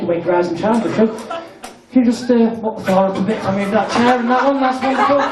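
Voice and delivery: ordinary talk, nothing marked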